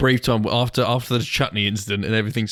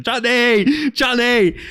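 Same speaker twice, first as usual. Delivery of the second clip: very high, raised nickname call; mock taunting call; 12 semitones higher, 5 dB louder, much slower than usual. Friends greeting the posh bloke every time, high taunting call. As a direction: call it out high, imitating the hecklers.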